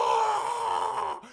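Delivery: loud high yelp of joy; freaking out. Retold or imitated. After Obama's praise, loud high joyful yelp. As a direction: lose your cool completely. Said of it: "I just freak out".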